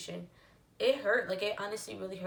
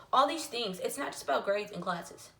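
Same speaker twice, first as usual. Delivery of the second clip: ordinary talk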